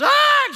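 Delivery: very high, loud woman's shriek; short piercing scream of panic; imitated scream. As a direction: let out a loud mock scream. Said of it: "She's screaming".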